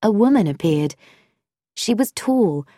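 ordinary delivery

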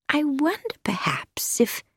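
tentative, trailing into a pause; delicate hesitation. Acted. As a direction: feel your way delicately, then leave it hanging. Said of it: "she paused delicately".

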